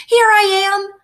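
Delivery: high, loud answering cry; calling back. A narrator's reading. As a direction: shout back from far inside. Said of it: "called back".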